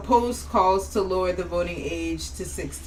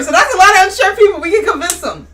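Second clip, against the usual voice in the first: excited; 10 semitones higher, 10 dB louder, faster than usual. Real, not acted. Excited about poll numbers.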